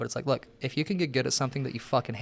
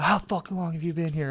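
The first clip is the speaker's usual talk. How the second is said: higher-pitched mock outrage; mildly indignant, pitched up; retold freak-out; 4 semitones higher than usual. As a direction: imitate someone freaking out, without much volume. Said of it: "he'd freak out".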